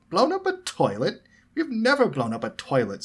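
high, loud indignant protest; disgruntled. A narrator's reading. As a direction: throw the accusation back, affronted. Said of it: "said George, disgruntled".

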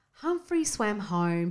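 usual speaking voice

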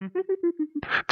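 high, breathy, whispered chuckle; hushed little laugh; soft laugh. Acted. After coaxing her to smile.

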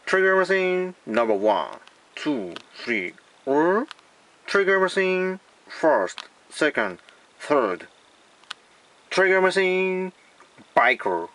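slow, drawn-out English product names; mock English accent. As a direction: announce toys like an English ad. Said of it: "英語っぽく言ってみます".

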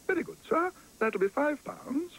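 the usher quotes Paddington a price, prim and high; posh usher. Acted. Narrator voices the usher.

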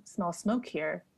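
ordinary talk

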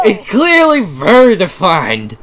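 slow, loud, high frantic voice; Markiplier freaking out. Acted. [name] protesting his accent, Markiplier freakout. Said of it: "just Mark, freaking out voice".

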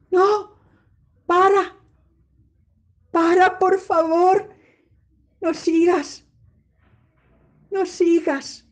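loud, high panicked cries; terrified pleading. Shown as a demonstration. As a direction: beg the thing to stop, shouting in fear. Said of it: "con una sesión de terror". (súplica aterrada a gritos, aguda y fuerte)

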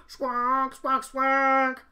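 loud honking squawk; honking taxi horn. Acted. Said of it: "honked a taxi horn".

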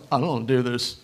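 low, sulky grumble of the lazy self; mock a reluctant, stubborn inner voice; lazy flesh voice. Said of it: "my flesh goes".

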